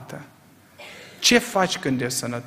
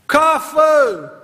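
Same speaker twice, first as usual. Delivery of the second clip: desperate cry; 11 semitones higher, 8 dB louder than usual. Retold or imitated. Preacher mocking people crying for coffee, desperate shout. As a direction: shout it high and loud like a desperate plea. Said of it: "E un strigă disperat".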